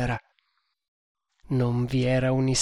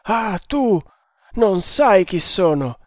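raised, menacing raving; delirious defiance; 5 semitones higher than usual. Acted. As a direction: challenge them wildly, voice lifted. Delirious man threatens the servant.

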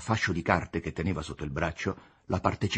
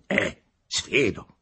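slow, louder sardonic jab; seething sneer; 3 semitones higher, 6 dB louder, much slower than usual. A narrator's reading. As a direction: sneer while quivering with anger. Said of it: "ghignò fremente il Costa". (ghigno fremente)

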